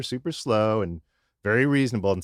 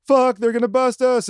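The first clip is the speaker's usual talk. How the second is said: very high, louder panic; terrified of getting caught; panicked; 13 semitones higher, 6 dB louder than usual. Retold or imitated. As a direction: friends panic seeing cops. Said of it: "And we're like".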